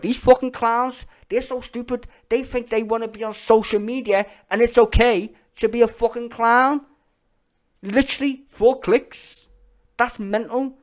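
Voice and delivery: high, loud scornful rant; angry rant. Real, not acted. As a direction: spit contempt at the clowns. Ranting about clowns online.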